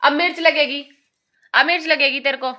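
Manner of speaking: loud teasing scold; mock warning. Real, not acted.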